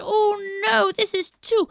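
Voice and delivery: high, loud, slow complaint; high loud girl voice, drawn out; Goldilocks complaining. Acted. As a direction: whine that the bed is too hard. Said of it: "She said".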